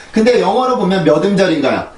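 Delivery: ordinary talk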